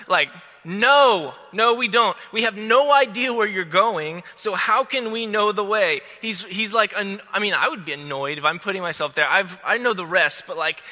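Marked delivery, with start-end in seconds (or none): 0.6-6.0 louder, higher mock protest; lightly acted exasperated disciple; doubting Thomas. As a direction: protest in disbelief. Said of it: "doubting fashion".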